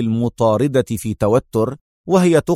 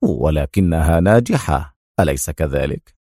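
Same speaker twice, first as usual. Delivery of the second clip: smiling; 8 semitones lower than usual. A narrator's reading. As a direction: tease her gently. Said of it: "ابتسم وهو يقول".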